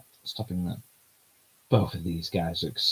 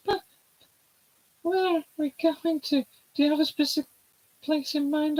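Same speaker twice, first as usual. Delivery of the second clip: put-on bright girlish character voice, much higher; high heroine voice. Voicing the heroine asking where the trip goes, high girlish voice. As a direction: play the young heroine, light and high.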